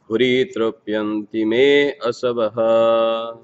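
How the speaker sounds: measured, rhythmic Sanskrit verse recitation, each line set apart. Acted. Reader reciting the Bhagavatam verse to listeners, measured and devotional.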